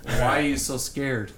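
low, slow, hushed menace; lightly done Jack Nicholson Wolf; Jack Nicholson impression. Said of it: "let me do Jack Nicholson Wolf impression".

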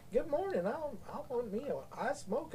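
high-pitched imitated Southern customer; Southern accent. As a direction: mimic a drawling Texas customer. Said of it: "enough people say, like".

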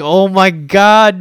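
high, loud, drawn-out gushing; retold squeal of delight. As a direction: melt over something adorable, very slowly. Gushing over little kids speaking Korean.

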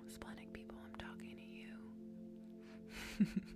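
soft secret whisper. Acted. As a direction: whisper it as a private aside. Whispered aside to splenic people.

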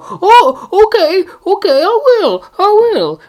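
put-on high eager child voice; obedient kid voice. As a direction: chirp it like a dutiful kid. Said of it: "you as a kid go".

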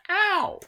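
high-pitched mock "ow"; sudden high cry of pretend pain; acted yelp. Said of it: "I'd probably say".

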